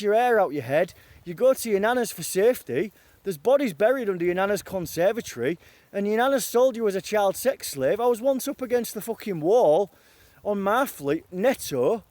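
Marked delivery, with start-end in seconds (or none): none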